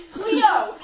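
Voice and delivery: loud, high shout of a name. A narrator's reading. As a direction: call out hard through a closed door. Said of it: "he shouted".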